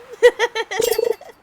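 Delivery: cracking up at her own quip; loud burst of laughter. Real, not acted.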